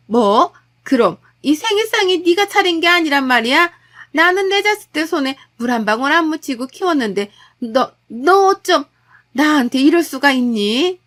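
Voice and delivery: aghast mother-in-law hurt and accusing; mother-in-law learns son cooked, outraged and wounded; indignant mother-in-law. Acted.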